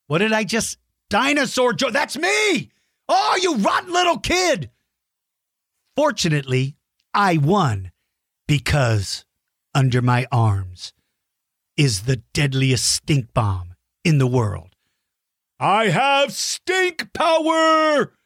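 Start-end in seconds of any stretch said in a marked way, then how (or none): 0.1-4.7 high hammed-up shout; mock outrage. 15.6-18.1 high, slow hammed-up triumphant shout; mock boast. Mock boast after winning.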